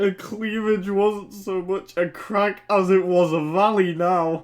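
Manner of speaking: real lingering laughter; giggly reading. Real, not acted. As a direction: read while trying not to laugh.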